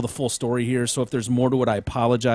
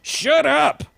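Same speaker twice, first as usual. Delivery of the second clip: high, louder snapping voice; imitating Ronaldo; 4 dB louder than usual. Host voicing the patient ignoring the cops, high snap. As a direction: snap it up high like a kid playing. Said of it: "And he's like".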